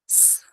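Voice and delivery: sustained sharp "sss"; soft whistle-like s sound on its own; whistling hiss. Shown as a demonstration. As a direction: hiss a thin s without voice. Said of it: "এরকম একটা আওয়াজ হবে".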